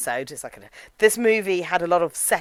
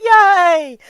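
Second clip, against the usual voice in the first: mock cheer; 10 semitones higher, 7 dB louder than usual. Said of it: "together we're like".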